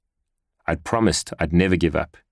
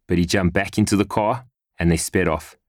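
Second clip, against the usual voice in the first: plain reading voice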